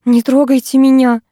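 cornered woman crying out in panic; panicked cry. A narrator's reading. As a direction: scream «Не трогайте меня» in panic. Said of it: "с паникой в голосе воскликнула".

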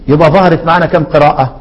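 ordinary delivery

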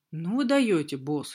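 plain reading voice

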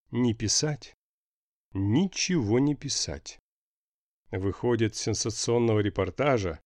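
usual speaking voice

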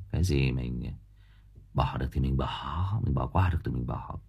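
low, soft, letting it go; buông xuôi nhẹ nhàng. Real, not acted. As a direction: drop low and quiet, as if waving it off. Urges letting things go. (giọng trầm, nhỏ, buông xuôi)

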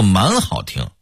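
"蛮好听" with emphasis on 蛮; Hong Kong-Taiwan wording. Shown as a demonstration. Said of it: "非得说成".

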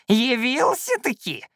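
put-on high, drawn-out old man's cry; creaky yell. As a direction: crow it accusingly in a creaky voice. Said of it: "заорал он скрипучим голосом".

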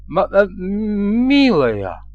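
halting stammer climbing to a high question; flustered stammer. A narrator's reading. Groom surprised by his bride's head at the door, stammering. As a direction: stumble over the words, voice rising at the end. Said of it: "Не заикаясь не хуже присутствующего здесь Фёдора".

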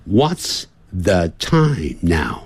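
"What's the time now?" drawn out slowly, word by word; slow teaching demo. Shown as a demonstration. Teacher modelling "What's the time now?" slowly for students.

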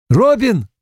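high, joyful call of her name; overjoyed brother shouting down the phone; happy shout. A narrator's reading. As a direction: ring out her name with delight. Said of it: "закричал ей в ухо счастливый голос".

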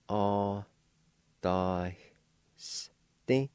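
A, da, s sounded out one by one with a faint H; long syllables, hissed s by itself, short final ti. Shown as a demonstration. Teacher shows learners the faint H, syllable by syllable. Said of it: "faint H sound right for the Y".

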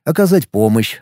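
ordinary delivery

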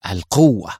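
understated fierce declaration of القوة; القوة spoken with a hint of savage hunger. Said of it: "نطقتها في شراسة عجيبة".

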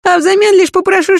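a hint of a sly petitioner; lightly voiced wheedling guest; sly offer. Kazarin offers a bribe. (вкрадчиво)

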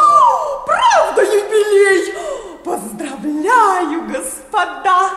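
loud, slow, gushing congratulations; bursting with delight; thrilled shout. Acted. Wife gushing over the jubilee to the gentlemen, loud and thrilled.

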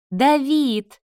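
high, loud cry of greeting; excited cry. A narrator's reading. Sister greeting her visiting brother, excited cry. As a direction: call out his name with delight.

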